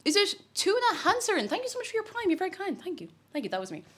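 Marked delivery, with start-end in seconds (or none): 3.5-3.8 softer, lower throwaway repeat; quiet aside. Pretend. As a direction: mutter it to yourself. Repeats the claim afterwards.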